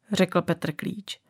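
plain reading voice

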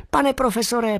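high, eager squeaking call; excited squeak. Acted. Bat squeaking happily to the professor. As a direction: squeak his name out in joy. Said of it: "pískal jsem o sto šest".

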